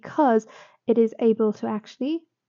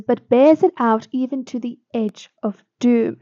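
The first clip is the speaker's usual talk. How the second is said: louder, declaimed line of verse; poem recitation; 7 dB louder than usual. Acted. Teacher quoting the sonnet's next line, declaimed and louder. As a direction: give the line its full poetic weight. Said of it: "he continues and he says".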